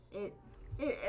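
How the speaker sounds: usual speaking voice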